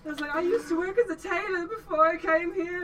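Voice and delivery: can't keep a straight face; laughing. Acted. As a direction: worker voice broken by laughter. GM voices worker through laughter.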